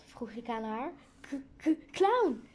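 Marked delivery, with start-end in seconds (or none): 1.2-2.4 stuttered start bursting into a high, loud cry; terrified stammer. A narrator's reading. Isabel spotting a clown behind her friend, stammering in terror. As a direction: choke on the first sound, then shriek.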